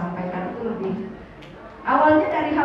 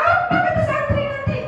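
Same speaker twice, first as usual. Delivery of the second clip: much higher, laughing through the words; 14 semitones higher than usual. Real, not acted. Speaker laughing while teasing his son about future students. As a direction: giggle out the question.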